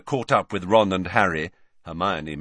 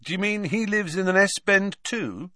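high-pitched teasing question; amused mock curiosity; rather amused; 12 semitones higher than usual. A narrator's reading. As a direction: tease with rising amusement. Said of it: "sounding rather amused now".

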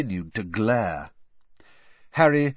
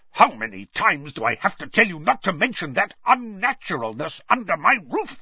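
high, tense, furious hiss; hissed angrily; 8 semitones higher, 3 dB louder than usual. Acted. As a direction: hiss the scolding, trembling with rage. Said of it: "he hissed".

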